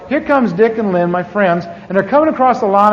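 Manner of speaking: usual speaking voice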